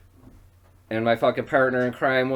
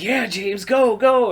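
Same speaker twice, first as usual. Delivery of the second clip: high, loud egging-on of a coworker; excited mock cheer, high and loud; 12 semitones higher, 9 dB louder, slower than usual. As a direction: imitate an older coworker cheering someone on, pitched way up. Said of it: "getting all excited".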